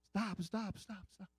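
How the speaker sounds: high, rapid-fire repeated call; retold urgent cry. Passenger urgently calls to driver.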